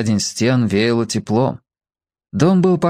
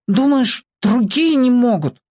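put-on challenging little boy; 12 semitones higher than usual. A narrator's reading. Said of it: "спросил он".

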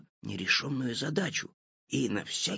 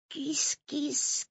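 high, slow kitty call; calling the cat; 14 semitones higher, much slower than usual. A narrator's reading.

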